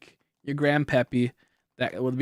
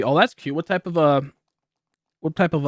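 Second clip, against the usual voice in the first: ordinary delivery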